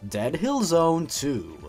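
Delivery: ordinary talk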